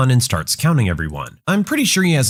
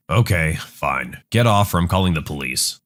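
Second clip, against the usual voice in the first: calm bus driver; 8 semitones lower than usual. Acted. Driver threatens police.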